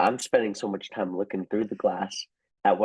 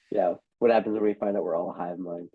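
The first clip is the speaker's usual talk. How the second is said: ordinary talk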